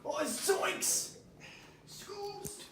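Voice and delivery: high cartoon exclamation; Shaggy. Told he looks like a cartoon character, playing it up. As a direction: yelp like a spooked cartoon slacker. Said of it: "You look like Shaggy".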